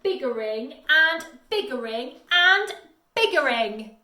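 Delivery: loud, high, furious yell; Once-ler yelling at the Lorax about biggering. A narrator's reading. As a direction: shout him down, building to a roar. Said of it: "I yelled at the Lorax".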